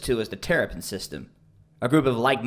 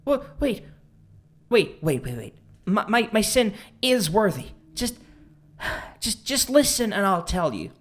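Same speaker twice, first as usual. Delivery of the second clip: panicked pleading; 2 semitones higher than usual. Acted. Cornered man begs her to listen, panicked pleading. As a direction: beg for a chance to explain, tripping over words.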